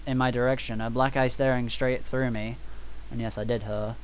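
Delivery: hushed, low story reading; visual novel narration. Acted. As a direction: read it softly and soberly.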